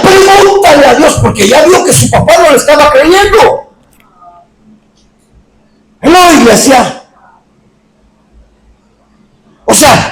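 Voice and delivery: predicación encendida, tono alto y sostenido; prédica enérgica. Acted. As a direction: proclama con fuerza ante la iglesia. Predica a plena fuerza. (predicación ferviente)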